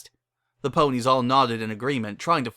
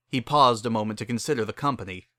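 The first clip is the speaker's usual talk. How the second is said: usual speaking voice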